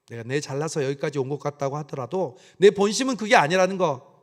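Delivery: ordinary delivery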